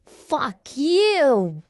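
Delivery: high, strained, stretched-out curse; acted pain. Bash kicked by the storyteller, cursing in acted pain. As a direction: squeeze it out as if hurt. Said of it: "he was having a pain".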